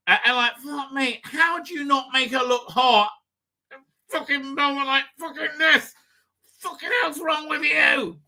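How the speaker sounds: high, exasperated rant at the show's costume choices. Real, not acted. Ranting at the show for dressing her like trash, exasperated and high.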